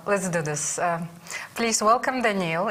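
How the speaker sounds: ordinary talk, nothing marked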